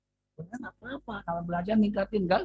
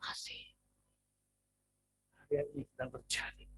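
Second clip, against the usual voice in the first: whisper; 3 semitones lower, 12 dB quieter than usual. Acted. Whispered answer after question.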